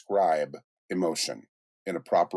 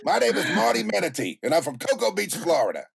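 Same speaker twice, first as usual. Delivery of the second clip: high, brash cartoon Floridian from New York; hammed-up angry manatee introducing himself; Marty Manatee; 10 semitones higher than usual. Cartoon manatee introduces himself to the audience, brash high voice. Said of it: "he talks like this".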